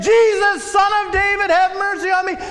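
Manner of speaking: high, loud, pleading shout; desperate shout again. Acted. Beggar shouting again over the hushing crowd, loud pleading cry. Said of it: "He shouts it again".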